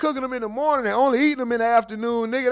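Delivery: fast, loud exasperated correction; heated rant. Real, not acted. Streamer correcting chatter Phoenix about grits, fast and loud. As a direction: rattle it off fast, fed up.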